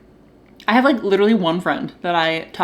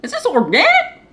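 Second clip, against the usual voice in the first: much higher, startled query; surprised question; 4 dB louder than usual. Real, not acted. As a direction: squeak it out in surprise. Reading the lid.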